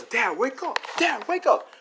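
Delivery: high, urgent cry to a sleeper; acted panicked son rousing his dad; urgent waking call.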